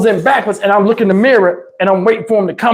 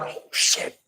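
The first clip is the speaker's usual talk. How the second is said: 1 whispered oh shit; 13 dB quieter than usual. Retold or imitated. Girls after hitting his car.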